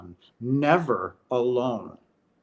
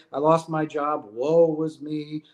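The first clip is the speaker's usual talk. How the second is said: self-pitying whine. Retold or imitated. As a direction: ham up the self-pity. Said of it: "if you wanna say".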